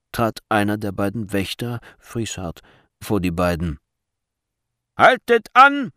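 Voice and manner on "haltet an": loud, high order to halt; sharp, forceful command; commanding shout. A narrator's reading. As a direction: shout it like a guard stopping someone. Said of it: "rief er in befehlendem Ton".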